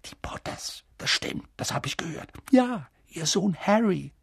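hushed gossiping whisper. Acted. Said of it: "nach den Flüsterern".

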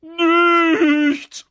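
very high, loud, stretched word into cackling; triumphant taunt. A narrator's reading. Peeves tricking Filch, piercing gleeful cry and cackle. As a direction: crow it, then burst out laughing.

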